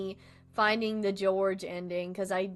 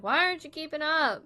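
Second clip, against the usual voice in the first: mild mock annoyance; 7 semitones higher, 4 dB louder than usual. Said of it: "so he gets mad about it".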